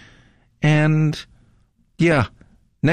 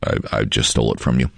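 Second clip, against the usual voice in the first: normal talking voice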